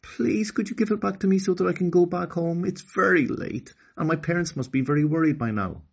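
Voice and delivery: put-on high pleading girl's voice, fast; girl pleads with the queen, high and fast; imploring girl. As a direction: beg to be allowed home. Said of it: "implored Okani".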